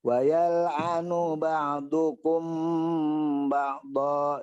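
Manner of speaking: "yal'anu ba'dukum" joined smoothly, slow; smooth nun link. Shown as a demonstration. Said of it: "Sambungnya nunnya coba".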